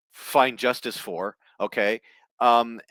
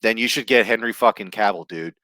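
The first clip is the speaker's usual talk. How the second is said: usual speaking voice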